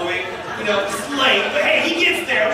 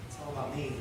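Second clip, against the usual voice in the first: quieter, lower repeat; softer echo; 9 semitones lower, 15 dB quieter, faster than usual. Retold or imitated. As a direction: let it fall away softly. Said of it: "repeated softer".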